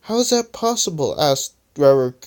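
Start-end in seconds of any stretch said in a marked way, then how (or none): 0.0-1.1 high-pitched curious question. A narrator's reading. Rubber puzzles over the lost future, curious. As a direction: ask it high, full of wonder.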